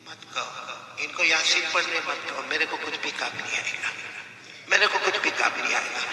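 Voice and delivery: very soft, weakened voice; dying student's plea. Retold or imitated. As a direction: plead faintly with your teacher. Dying student refuses recitation. (मरते शागिर्द की धीमी आवाज़)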